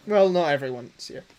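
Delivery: usual speaking voice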